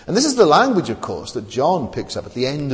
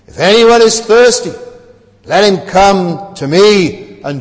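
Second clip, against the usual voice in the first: proclaimed loud and slow, booming; loud proclamation; 9 dB louder, slower than usual. Retold or imitated. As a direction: call out to the whole crowd slowly. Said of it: "he said in a loud voice".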